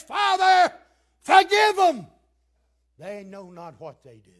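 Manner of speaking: high, loud plea to the Father; Jesus calling out from the cross, pleading; crying out as Jesus. Acted. Jesus on the cross to the mob's Father: loud pleading cry. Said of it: "he says".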